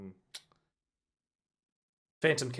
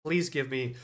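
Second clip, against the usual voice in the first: ordinary delivery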